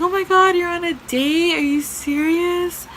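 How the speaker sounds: high, loud whiny imitation of an upset girl; mock crying. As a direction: mimic a tearful caller's outrage. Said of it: "crying on the phone".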